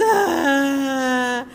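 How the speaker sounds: loud mock crying "ah"; imitated child's wail. Speaker imitating the crying girl on the airport bus, mock wail. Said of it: "e a menina chorando".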